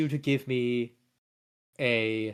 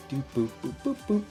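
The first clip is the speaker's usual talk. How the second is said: soft idle boop-boop sounds; little beeping noises; 8 dB quieter, faster than usual. Real, not acted. Hums beeps while rolling dice.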